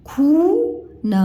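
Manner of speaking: खून with the uu held long; long uu stretched. Shown as a demonstration. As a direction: draw out खून slowly. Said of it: "बड़ा ऊ की मात्रा है". (खून लंबे ऊ के साथ धीरे)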